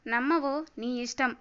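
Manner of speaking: usual speaking voice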